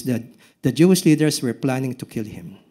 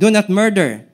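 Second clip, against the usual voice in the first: stern command; 7 semitones higher, 5 dB louder than usual. Acted. Quoting the old commandment loudly.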